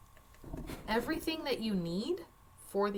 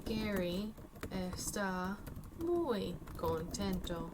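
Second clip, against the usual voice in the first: Gary está muy contento, slow and even; slow dictation; slower than usual. Shown as a demonstration. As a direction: dictate it slowly while typing. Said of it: "So I can start with that".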